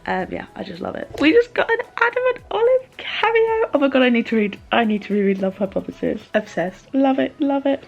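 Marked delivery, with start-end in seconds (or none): 1.2-7.8 high, loud excited squealing. Real, not acted. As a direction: gush with delight. Excited reaction to a cameo.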